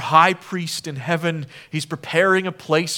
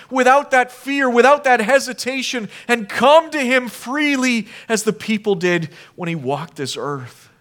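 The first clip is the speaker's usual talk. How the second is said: fervent preaching; 8 semitones higher, 9 dB louder than usual. Acted. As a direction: preach it out, louder and higher. Preaching at full force.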